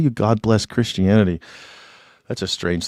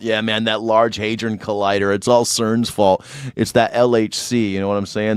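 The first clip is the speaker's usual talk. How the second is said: ordinary talk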